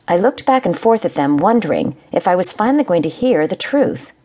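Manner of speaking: ordinary delivery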